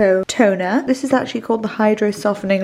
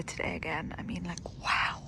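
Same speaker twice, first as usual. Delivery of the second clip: whispering; 5 semitones lower, 14 dB quieter than usual. Real, not acted. Quiet near the horses.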